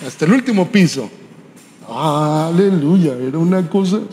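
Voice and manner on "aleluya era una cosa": awed exclamation. Real, not acted. Preacher marvels at hotel suite.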